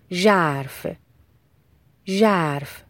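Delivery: ژرف said carefully with a clear voiced zh; teacher modelling the ژ in ژرف; careful model pronunciation. Shown as a demonstration. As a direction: enunciate the zh opening ژرف, pausing between repeats. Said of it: "تلفظ صحیح حرف ژ".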